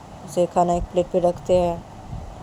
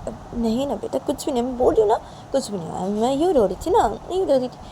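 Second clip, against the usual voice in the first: lightly acted tearful mother's denial; higher-pitched, halting reassurance; mother brushing off worry; 6 semitones higher than usual. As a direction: deny it in a higher voice, then ask back.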